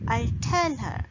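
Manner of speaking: "I'll tell her" peaking on "tell", then falling; stress on tell. Shown as a demonstration. Teacher demonstrating for learners, stress on "tell". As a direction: lean hard on "tell". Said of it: "we put the stress on tell".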